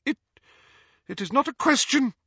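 flustered, high-pitched stammer. A narrator's reading. Ghost loses composure before Harry, stammering. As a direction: falter on the first word, then restart. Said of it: "she stammered".

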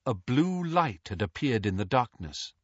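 ordinary delivery

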